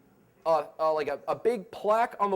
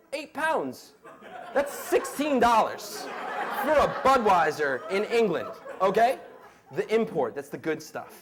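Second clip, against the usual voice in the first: imitating English bartender; 4 semitones higher, 4 dB louder than usual. Bartender quoting beer price.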